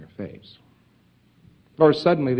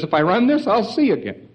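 higher, louder sudden realisation; 9 semitones higher, 4 dB louder than usual. Retold or imitated. Patient suddenly realising during the session, higher and louder. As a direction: blurt it out like a discovery. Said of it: "the fellow all of a sudden says".

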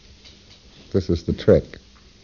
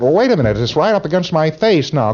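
raised-pitch mock alarm; acted alarm; 7 semitones higher than usual. Subject telling questioner it's against his face, mock alarm. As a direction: interrupt in lightly acted alarm. Acting subject as mass closes in.

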